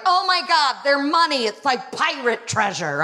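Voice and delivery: high, gushing American delight; mock-gushing sister; excited sister. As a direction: gush over the coins like a thrilled tourist. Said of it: "she was like".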